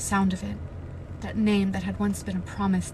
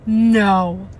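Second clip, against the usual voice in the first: loud anguished no; shouted no; 11 dB louder than usual. Real, not acted. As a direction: shout it in shock. Reader cries out.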